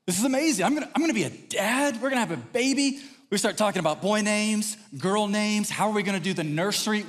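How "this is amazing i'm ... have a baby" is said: high-pitched, giddy retold excitement; ecstatic. New father learning of pregnancy, ecstatic. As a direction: gush with delighted disbelief. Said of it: "We are so ecstatic about this".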